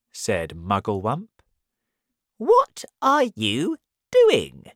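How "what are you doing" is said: high, loud, slow question; high-voiced monkey, word by word; inquisitive monkey. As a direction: call the question out like a storybook monkey.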